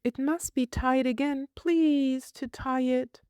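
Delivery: put-on high, prim little girl asking. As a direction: voice a fussy small girl, pitched high. Child voice.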